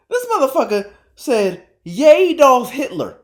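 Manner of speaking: high, loud, slow laughing disbelief; laughing in disbelief at the tweet; disbelieving laugh. Real, not acted. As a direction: laugh it out in shock. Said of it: "I'm laughing 'cause I'm in disbelief".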